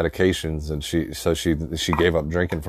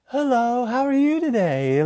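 very high, sing-song lady on the phone; sweet phone voice. Retold or imitated. Grandma answering a telemarketer's call, sweet phone voice. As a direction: imitate grandma putting on a sugary, polite telephone voice. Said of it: "they get that fucking phone voice".

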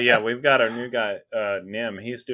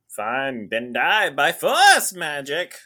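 loud, high mock yell, stretched in the middle; angry outburst; 6 semitones higher, 5 dB louder, much slower than usual. Stubborn hero finally gives in to her, yelling. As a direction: burst out in hammed-up fury.

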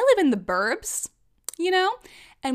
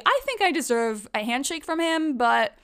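usual speaking voice

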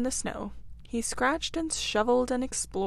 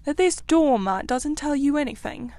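high, loud disbelief; agitated, raised-voice question; excited; 6 semitones higher, 6 dB louder than usual. A narrator's reading. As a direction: demand an answer, all worked up. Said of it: "cried the excited rat".

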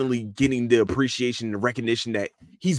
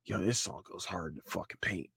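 muttered hype; 5 semitones lower, 16 dB quieter than usual. Retold or imitated. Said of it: "I'm just like".

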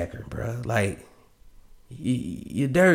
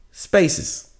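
normal talking voice

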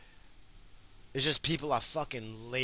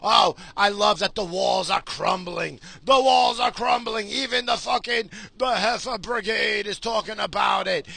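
high, loud triumphant cheer; loud gleeful triumph; gleeful shouting; 7 semitones higher, 5 dB louder than usual. Real, not acted. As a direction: crow about the walls falling. Gleeful about crumbling walls.

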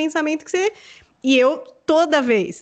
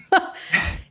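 short unvoiced chuckle; a small breathy laugh after the joke; quick laugh; 6 semitones higher than usual. Real, not acted. Laughs at own joke to listeners. (risadinha curta e sem voz)

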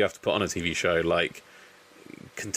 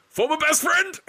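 very high, strained imitation; weird voice; 4 dB louder than usual. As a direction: squeal it, mocking his odd delivery. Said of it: "in the weirdest fucking voice".

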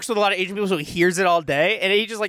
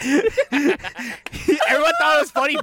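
normal talking voice

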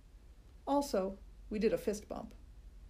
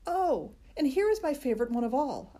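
put-on louder, higher eager kid; excited announcement; 5 semitones higher, 5 dB louder than usual. A narrator's reading. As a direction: announce the best one proudly. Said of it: "Junie B. announces her favorite".

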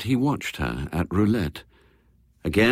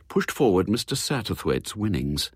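ordinary delivery, nothing marked

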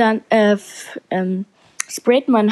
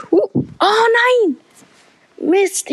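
dismayed cry; 12 semitones higher, much slower than usual. Real, not acted. Crashing into a barrier.

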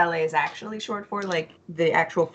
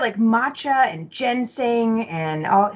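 usual speaking voice

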